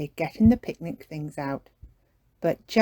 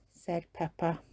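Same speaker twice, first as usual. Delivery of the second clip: plain reading voice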